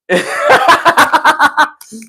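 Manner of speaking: high burst of real laughter; loud high laugh bursting out. Real, not acted. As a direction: crack up at your own joke. Laughs at the jail line.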